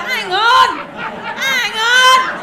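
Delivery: mock excited yelp. Comic retelling her own thrill at the crowd, high excited cry. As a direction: squeal it like you've been caught out. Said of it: "I was like".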